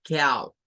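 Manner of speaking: cow said with the ow sound; cow, clearly pronounced; English cow. Shown as a demonstration. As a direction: cow demonstrated for students. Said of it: "la pronunciación de este".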